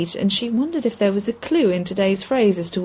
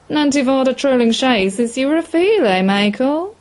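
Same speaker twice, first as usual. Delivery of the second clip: put-on high, louder old pub regular; old man; 5 semitones higher, 3 dB louder than usual. A narrator's reading. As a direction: tease the manager, rising to a high questioning end. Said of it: "one of the old men turned to Michael".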